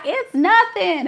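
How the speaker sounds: high, drawn-out imitation; mock nonchalant reply. Imitating drivers dismissing twelve hours, high mock nonchalance. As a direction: voice them slowly and up high. Acts long-distance drivers shrugging it off.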